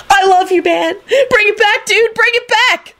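very high squealing cheer; jubilant scream. A narrator's reading. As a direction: scream with delight in a high character voice. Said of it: "Kenny screamed jubilantly".

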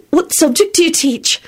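high, polite question; polite woman. A narrator's reading. Beatrice makes conversation with Lupin, politely. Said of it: "Beatrice asked politely".

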